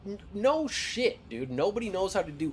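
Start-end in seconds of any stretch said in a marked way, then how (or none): 0.1-1.5 exasperated. Real, not acted. Exasperated retort to customer.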